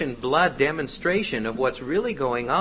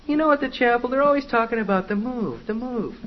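high, raised mock-complaining voice; complaining girl; 9 semitones higher, 4 dB louder, faster than usual. Girl repeats the planted thought to friend, complaining. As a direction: play a girl griping to her friend, pitched up. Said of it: "she says to her friend".

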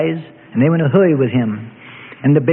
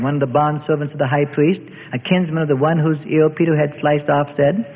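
ordinary delivery, nothing marked

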